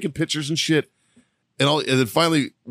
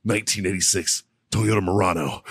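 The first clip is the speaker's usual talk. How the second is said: mock a creep murmuring sweet nothings; imitating a creepy seducer, low and hushed; creepy seductive whisper; 6 semitones lower, 3 dB quieter than usual. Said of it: "he's like".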